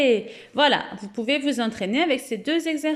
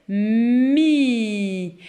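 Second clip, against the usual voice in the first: very slow sounding out; 4 dB louder than usual. Shown as a demonstration. Teacher to pupils, drawing out mi very slowly. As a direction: drag every sound of pour écrire mi. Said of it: "Je prends M".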